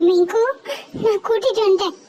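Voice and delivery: ordinary delivery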